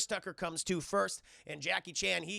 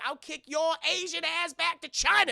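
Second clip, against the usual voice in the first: mock Chris Tucker threat; 10 semitones higher, 9 dB louder than usual. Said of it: "he's like".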